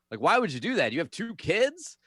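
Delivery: high, loud indignant rant at dad; pissed off kid. Retold or imitated. Boy angry at dad over sister's yo-yo, loud indignant. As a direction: let the betrayed kid vent his outrage. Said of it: "pissed off about it now".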